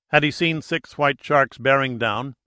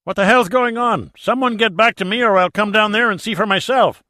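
high, loud, frantic yelling; anxious shouting; 10 semitones higher, 8 dB louder than usual. Acted. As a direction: shout it over the radio in a panic. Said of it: "anxiously shouting over the radio".

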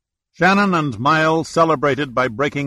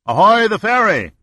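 much higher, louder hail; shouting across water; 9 semitones higher, 6 dB louder than usual. A narrator's reading. Boat owner hailing the ferry across the water, loud hail. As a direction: call it out to the next boat. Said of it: "He shouted across the water".

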